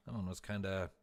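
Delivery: ordinary delivery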